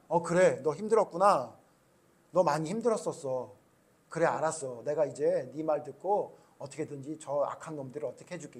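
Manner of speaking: gentle comforting reply. Pretend. Imagined gentle God reply.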